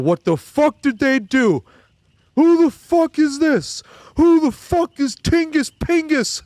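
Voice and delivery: high, loud, slow New York heckler yell; mock a furious fan at the TV; angry Knicks fan. Streamer imitating the Knicks fan yelling at the draft. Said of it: "He's like".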